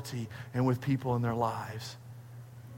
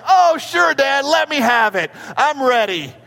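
mock eager child; 8 semitones higher, 5 dB louder than usual. Daughter imagined eager for spanking.

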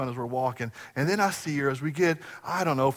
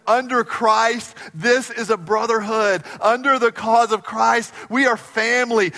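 raised, ringing preacher's voice; full-force preaching; 7 semitones higher, 6 dB louder than usual. Acted. Pastor preaching to the congregation, raised and ringing. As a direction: preach it big to the room.

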